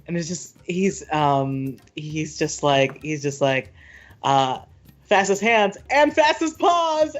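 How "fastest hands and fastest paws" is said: high, sing-out playful boast; gleeful high-pitched taunt; teasing taunt. Acted. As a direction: crow it high, making fun as you race. Player voices a gleefully teasing character.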